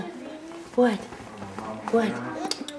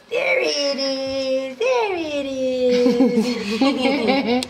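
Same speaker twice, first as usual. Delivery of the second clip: drawn-out cooing; much slower than usual. Real, not acted. Adult delighted at baby's tongue, drawn-out cooing. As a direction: coo it slowly, holding out each word.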